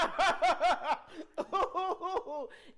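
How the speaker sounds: loud, high burst of laughing; loud laughter. Real, not acted. Guest reacting to the word daddy, loud laughter. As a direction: crack up helplessly. Cracks up over saying daddy.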